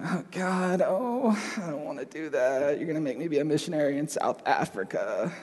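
mock whining complaint; whining believer. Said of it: "We shouldn't every time".

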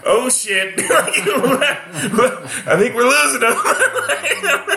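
high, laughing alarm; laughing panic. Retold or imitated. Host watching the comic collapse, laughing high. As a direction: gasp it through laughter. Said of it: "I was like".

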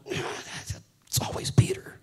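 slow whispered grumble; whispered aside. Acted. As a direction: whisper it like a muttered complaint. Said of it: "James and John going".

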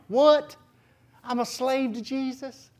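high-pitched incredulous question; startled, then hushed and questioning; mock disbelief. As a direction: act out stunned realisation in a high voice. Acts out own startled reaction.

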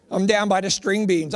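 ordinary talk

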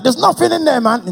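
high, loud indignant reply; exasperated protest. Retold or imitated. Dwayne protesting to God that the account is empty.

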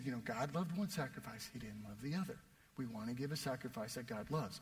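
low, soft murmur to a friend; a quiet aside in church; hushed advice. Retold or imitated. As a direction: lean in and say it under your breath. Friend leans over during service.

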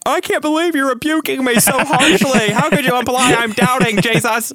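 hammed-up hurt feelings, pleading with Jesus; offended sensitive protest. Believer protesting Jesus's rebuke, mock wounded outrage. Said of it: "I'm a sensitive person. ... I might be like".

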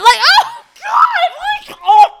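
very high laughing shriek; screaming laugh of disgust. Real, not acted. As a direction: squeal in grossed-out laughter. Reacting to creep impression.

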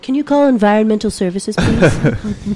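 mock polite. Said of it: "I have to be like".

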